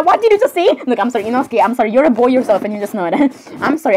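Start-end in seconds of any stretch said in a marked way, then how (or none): 0.0-0.7 high, mock-affronted question; shocked question. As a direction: pitch it up in disbelief. Acted shock at Merida.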